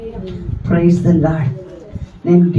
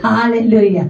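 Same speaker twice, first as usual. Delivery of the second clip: raised, high-pitched sermon exhortation; preaching; 4 semitones higher than usual. Acted. Pastor rousing the church, preaching with call and response. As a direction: preach it out to the congregation.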